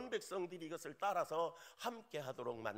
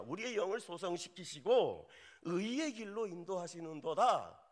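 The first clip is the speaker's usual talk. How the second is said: fervent preaching; 6 semitones higher, 7 dB louder than usual. Acted. Preached verse, rising.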